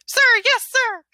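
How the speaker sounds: high, loud chanted reply; loud gung-ho soldier. Acted. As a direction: shout it back like recruits. Troops answer drill sergeant.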